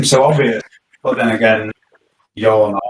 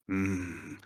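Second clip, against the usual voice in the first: lowered voice; 4 semitones lower, 11 dB quieter than usual. Real, not acted. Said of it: "I'll try and lower my voice".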